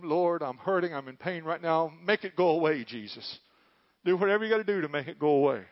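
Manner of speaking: loud begging plea to the Lord; pleading prayer. Retold or imitated. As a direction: beg Jesus to take the pain away. Mock pleading prayer to Jesus.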